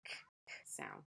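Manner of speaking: k, k, as a breathy bare k sound; sounding out words. Shown as a demonstration. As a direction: isolate the k, unvoiced. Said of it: "C makes the k".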